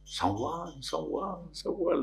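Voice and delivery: soft fading echo. Retold or imitated. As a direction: let it fade like an echo. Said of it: "like that". (soft fading echo)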